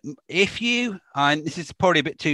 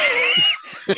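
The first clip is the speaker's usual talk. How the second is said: squealing burst of laughter; high shrieking laugh. Real, not acted.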